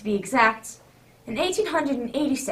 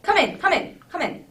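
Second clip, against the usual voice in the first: calling out a loud, high welcome; hearty welcome; 6 semitones higher, 6 dB louder than usual. Acted. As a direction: beckon the guest in heartily. Host welcoming guest at door.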